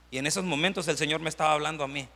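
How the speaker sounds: ordinary talk, nothing marked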